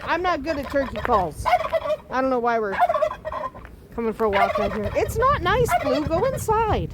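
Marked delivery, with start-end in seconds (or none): 4.9-6.9 high sweet dog-talk voice. Real, not acted. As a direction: coax the dog in, pitched up. Talking to the dog Blue.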